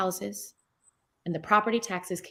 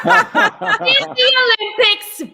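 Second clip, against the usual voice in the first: laughing; 13 semitones higher, 10 dB louder, slower than usual. Real, not acted. Laughing at her own joke.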